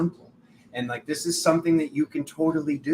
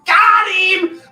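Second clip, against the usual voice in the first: much higher and louder cowboy whoop; triumphant shout; 13 dB louder than usual. Retold or imitated. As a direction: crow in victory like a gunslinger. Triumph after winning the stranger over.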